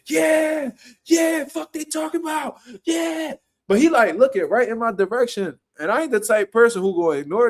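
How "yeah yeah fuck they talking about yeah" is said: high, hyped-up shout at the crowd; mock hype yell. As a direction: shout it across the gym like a rowdy fan. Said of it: "the one boy like".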